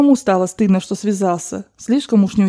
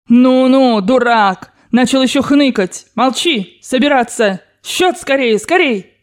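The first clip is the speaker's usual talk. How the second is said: higher, insistent, cross commands; furious ordering; 4 semitones higher, 3 dB louder, slower than usual. Acted. Grandmother silencing her whining servant, furious orders. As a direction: cut off the whining and hurry them. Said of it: "чтоб остановить её фурор".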